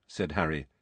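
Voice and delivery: ordinary delivery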